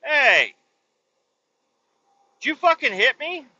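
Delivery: high, loud indignant challenge; mock outrage. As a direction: confront the guy who just hit you. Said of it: "and then they're like".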